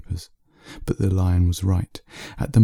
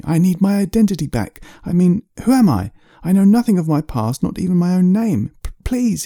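much higher, desperate pleading that cracks on please; begging; 11 semitones higher, 3 dB louder than usual. Acted. Man begging the lion for his identity papers, desperate. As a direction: plead for your life story back. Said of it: "he begged".

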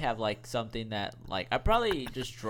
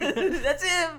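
laughing; 3 dB louder than usual. Real, not acted. Both laughing at the joke.

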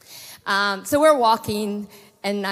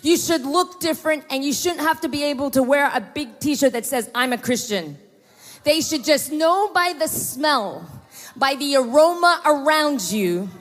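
emphatic, higher preaching voice; fervent preaching; 5 semitones higher, 4 dB louder than usual. Acted. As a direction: preach it at the crowd, raised. Preaching to congregation.

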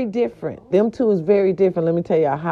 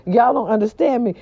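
ordinary talk

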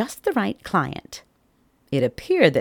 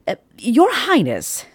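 timid servant; 8 semitones higher, 4 dB louder than usual. Acted. Nervous servant addressing the king.

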